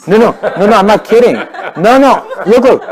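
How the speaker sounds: loud, high-pitched insistence; insistent protest. Real, not acted. Speaker to a laughing audience, loud insistent protest.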